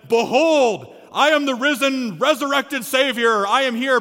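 loud, lofty risen-savior voice; grand proclamation. Acted. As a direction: boom it out like a triumphant king. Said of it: "Jesus could have walked up and said".

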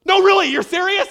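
very high, loud disbelief; hammed-up astonished question; beside herself. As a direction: shout it as a mom who can't take it in. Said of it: "She was just beside herself".

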